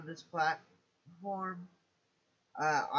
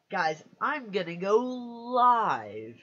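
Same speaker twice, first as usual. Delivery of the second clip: loud, high, drawn-out hype; excited streamer imitating own announcement; hyped announcement; 6 semitones higher, 8 dB louder, much slower than usual. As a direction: announce it big, stretching the words.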